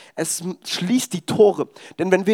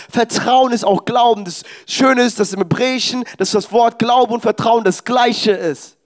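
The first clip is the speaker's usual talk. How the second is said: full-force preaching voice; 3 semitones higher than usual. Acted. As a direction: lift the congregation, push it out with conviction. Preaches the main point at full force.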